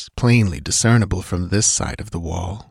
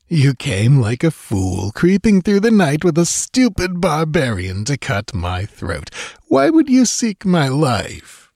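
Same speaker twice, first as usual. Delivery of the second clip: mocking amusement; 6 semitones higher than usual. A narrator's reading. Priest mocks the dancer's night attack, amused. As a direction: jeer with lofty amusement. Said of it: "laughed Totrasmek".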